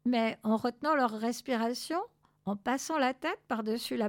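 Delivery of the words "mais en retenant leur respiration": ordinary delivery, nothing marked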